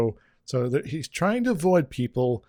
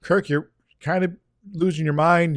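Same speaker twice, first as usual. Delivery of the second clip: normal talking voice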